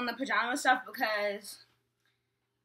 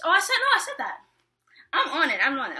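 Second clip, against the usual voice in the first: excited; 6 semitones higher, 8 dB louder, faster than usual. Real, not acted. Reacting loudly to someone off camera.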